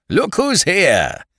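high, slow tipsy greeting; drunken cheer. Acted. Freddie cheering at the police from the taxi, tipsy. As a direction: hail them like a merry drunk. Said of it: "cried the Honourable Freddie".

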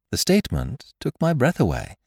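ordinary delivery

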